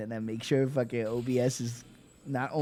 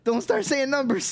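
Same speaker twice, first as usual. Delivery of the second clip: much higher, louder hammed-up squeal; mock shriek of panic; 13 semitones higher, 6 dB louder than usual. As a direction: squeal the warning in comic alarm. Repeat, even higher.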